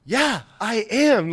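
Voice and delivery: loud, high, drawn-out retort; mock defiance. Sergeant answers her tirade, loud mock defiance. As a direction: throw it back at her, hammed up.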